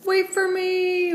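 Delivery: high, slow drawn-out call. A narrator's reading. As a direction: call after a friend ahead. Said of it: "Bo called".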